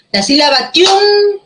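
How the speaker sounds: "tiún" held long and pitched high; slow down and lean hard on "tiún"; stressed syllable tiún. Shown as a demonstration. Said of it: "Conserva el acento". ("tiún" alargado y agudo, marcando el acento)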